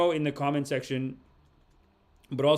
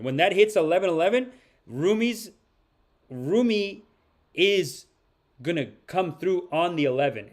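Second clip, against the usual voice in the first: excited; 4 semitones higher, 4 dB louder than usual. Real, not acted. Hyping the card at 11:11.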